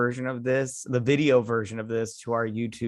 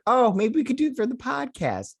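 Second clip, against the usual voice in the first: excited; 11 semitones higher than usual. Retold or imitated. Retelling own eager suggestion.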